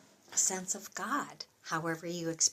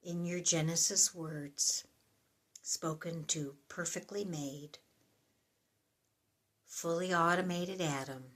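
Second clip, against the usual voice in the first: softer, reflective reading with long pauses; prayerful psalm reading; 5 dB quieter than usual. Acted. Addressing God at the close of a psalm, soft and prayerful. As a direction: let each phrase fall away softly, like a prayer.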